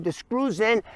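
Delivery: ordinary delivery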